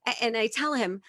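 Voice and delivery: ordinary delivery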